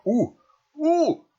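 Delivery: high, loud delighted squeal at the roll; excited squeal. Real, not acted. Player thrilled by a high roll, loud excited squeal. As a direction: gasp with joy at the dice.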